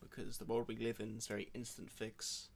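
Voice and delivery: put-on American accent, lightly done; podcast host faking an American accent; fake American accent. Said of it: "That sounds so American".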